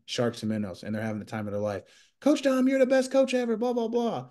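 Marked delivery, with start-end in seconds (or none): 2.2-4.3 much higher, mock childlike voice of the youth kids; imitating excited kids. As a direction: squeal it like a happy little kid praising the coach. Imitating kids praising coach.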